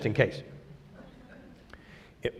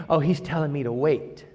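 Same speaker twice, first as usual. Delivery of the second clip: soft, lower mock acceptance; imitated resignation; 5 semitones lower, 9 dB quieter than usual. Believer explaining away an unanswered prayer, softly imitated. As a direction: drop low and quiet for the quote. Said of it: "So I say".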